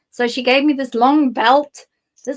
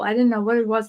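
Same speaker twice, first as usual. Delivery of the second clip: ordinary talk, nothing marked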